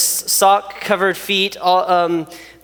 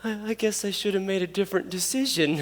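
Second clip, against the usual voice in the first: much softer, sheepish admission; sheepish kid; 9 dB quieter than usual. Retold or imitated. Pastor voicing the stickered kid's sheepish admission, much softer. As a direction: mumble it like a chastened kid. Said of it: "he's like".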